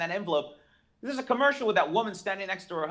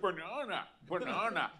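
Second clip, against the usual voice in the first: Sean Connery impression; 6 dB quieter than usual. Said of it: "in Sean Connery's voice".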